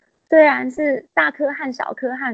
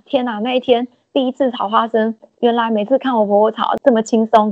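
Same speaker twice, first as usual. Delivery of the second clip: ordinary talk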